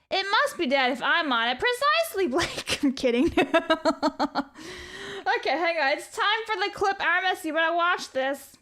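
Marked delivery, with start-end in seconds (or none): 3.4-5.3 real amused laugh. Real, not acted. Streamer kidding a viewer, amused laugh. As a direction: laugh at your own joke.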